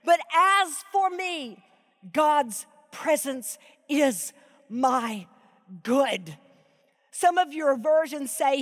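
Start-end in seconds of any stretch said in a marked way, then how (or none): 0.0-6.4 very slow, each word weighted; reverent, deliberate verse reading; slow emphatic reading. Shown as a demonstration. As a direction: linger over God's presence. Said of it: "he says the same phrase again".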